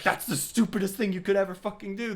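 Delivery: high, loud, put-on scolding voice; exasperated scolding. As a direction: berate him like a fed-up know-it-all. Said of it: "Mimir is just like".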